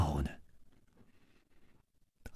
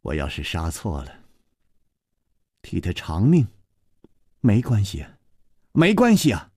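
sharp, higher bursts of a fierce confession; stern outburst; 6 semitones higher than usual. A narrator's reading. Gong Nanyan admits the killing and threatens Chu Liuxiang, fierce. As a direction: bite out the threat, rising as it goes. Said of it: "宫南雁忽然厉声说".

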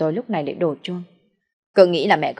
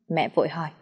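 usual speaking voice

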